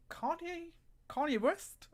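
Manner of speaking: high, baffled double take; surprised. Real, not acted. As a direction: question it in amused disbelief. Misread comment, baffled.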